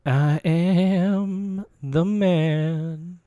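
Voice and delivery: sultry voice. Acted. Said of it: "And that sultry voice".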